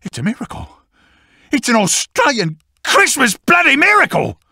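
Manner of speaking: high awed start rising to jubilant yell; overjoyed, building. Acted. Finder celebrating an Australian Christmas miracle, building jubilation. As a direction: build from hushed wonder to a high cheer.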